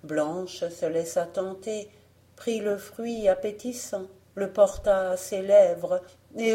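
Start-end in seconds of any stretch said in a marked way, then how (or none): none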